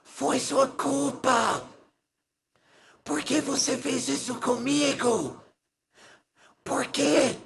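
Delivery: high, soft, slow, breaking ghostly wail; broken dead-wife voice. A narrator's reading. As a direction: plead from beyond the grave, voice cracking. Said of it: "numa voz quebrada".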